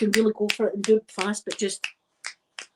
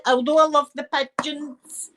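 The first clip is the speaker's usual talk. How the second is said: ordinary talk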